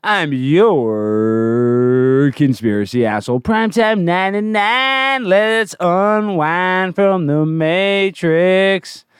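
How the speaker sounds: slow, sing-song announcer swelling into a rhyme; show announcer intro. Acted. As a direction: stretch the key words like a carnival barker.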